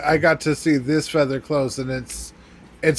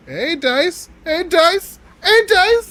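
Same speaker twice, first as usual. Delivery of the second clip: rising cheer, higher and louder each time; excited cheering; 6 dB louder, slower than usual. Real, not acted. Player hyping up the dice roll, excited building cheer. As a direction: chant it high, building to a loud shout.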